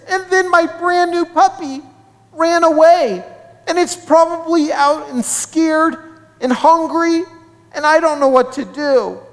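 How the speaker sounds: put-on high-pitched, upset little girl's whine; acted whimper, high all the way through. A narrator's reading. Anna telling classmate about her lost toy and puppy, whimpering. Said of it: "Anna whimpered".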